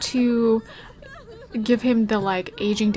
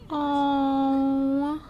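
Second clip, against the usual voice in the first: a choked, crying oh; tearful oh. Real, not acted. Streamer mourning her favorite dog, tearful oh. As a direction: let the grief break through.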